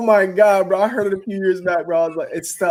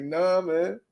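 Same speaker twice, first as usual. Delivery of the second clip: usual speaking voice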